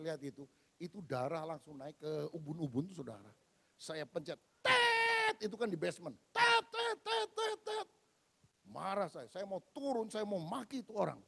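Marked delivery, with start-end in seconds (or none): 4.6-5.4 high, sharp honk imitation; car horn beeps. Preacher honking at the rude driver, sharp beep. 6.3-7.9 high, sharp honk imitation; car horn beeps. Preacher honking at the rude driver, sharp beep.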